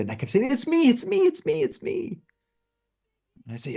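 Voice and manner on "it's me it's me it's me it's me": high, thrilled cry of recognition; suddenly realizing it's about him, squealing it out; excited realization. Real, not acted. Watching the video, he shouts it's about him, high and thrilled.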